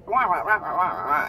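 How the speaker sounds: adult nagging mocked as "wah wah wah". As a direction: parody her nagging as noise. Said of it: "is all I'm hearing".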